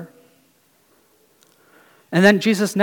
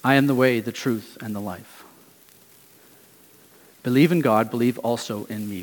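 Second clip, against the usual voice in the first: quiet, tender reassurance, fading softer; soft comfort; 5 semitones lower, 4 dB quieter than usual. Retold or imitated. Jesus comforting his disciples, softer and tender. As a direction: lower and softer, as if calming someone afraid. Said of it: "speaking these words out of comfort".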